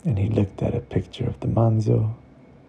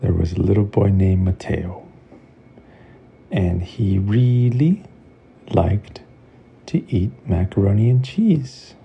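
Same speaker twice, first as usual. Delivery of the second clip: bedtime story narration; 4 dB louder than usual. A narrator's reading. Narrator opening a bedtime story for kids, gentle storybook voice. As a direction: read it like a bedtime tale.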